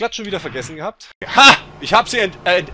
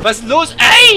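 loud call out; 11 semitones higher, 13 dB louder, slower than usual. Real, not acted. Reacting to chaos.

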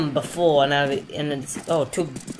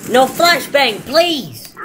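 high pleading cry; pleading yell; 8 semitones higher, 6 dB louder, much slower than usual. Real, not acted. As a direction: beg them not to flash. Pleading against flashbang.

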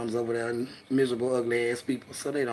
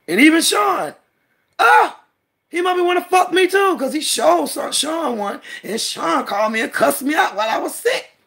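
high, loud outraged ranting; heated rant; 10 semitones higher, 6 dB louder than usual. Real, not acted.

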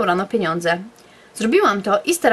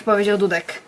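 usual speaking voice

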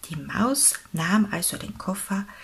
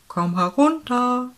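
louder, raised rabbit father's call; 4 semitones higher, 6 dB louder than usual. A narrator's reading. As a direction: call to someone up above. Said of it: "rief der Kaninchenvater".

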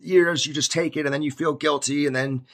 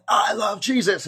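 mild, high-pitched joyful call; half-shouted exclamation, imitating a new believer; 6 semitones higher than usual. New believer asking God to let them proclaim it, mildly shouting. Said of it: "Can I shout out".

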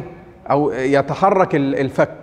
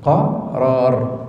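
low register; 5 semitones lower, much slower than usual. Shown as a demonstration. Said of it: "اسمه قرار".

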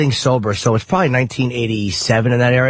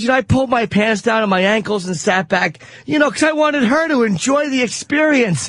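naive boss; 7 semitones higher than usual. Retold or imitated. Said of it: "He goes".

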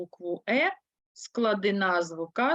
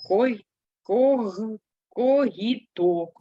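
slow syllable demo; 4 dB louder, much slower than usual. Shown as a demonstration. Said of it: "можна ще сказати".

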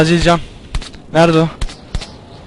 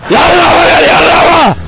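loud high-pitched yelling at the monster; panicked shout; 10 semitones higher, 4 dB louder than usual. Real, not acted. Gamer screaming at a monster on screen, loud panicked yell.

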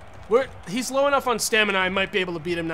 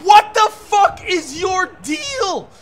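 very high, loud, slow anguished yell; furious outburst; 10 semitones higher, 9 dB louder, much slower than usual. Real, not acted. Player losing the pin to the referee, anguished furious yell. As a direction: howl at the ref in outrage.

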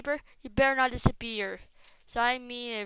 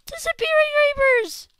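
much higher, much louder scream; outraged scream; 13 dB louder than usual. Real, not acted. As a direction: shriek it out in full disbelief. Outraged at vanishing creepers.

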